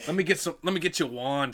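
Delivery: broad mock Boston accent. Shown as a demonstration. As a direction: improvise a wizard line in a Boston accent. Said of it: "how to do a Boston accent".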